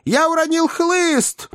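high, slow, drawn-out cry; exclaiming. A narrator's reading. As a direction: call out in a very high voice. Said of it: "воскликнул сей джентльмен". (высокий протяжный возглас)

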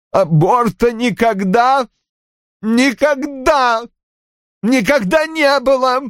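slow, very high sobbing denial; choked out through tears. A narrator's reading. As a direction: squeeze each phrase out while swallowing tears. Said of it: "выдавил Рой, глотая слёзы и всхлипывая". (выдавливать слова, глотая слёзы)

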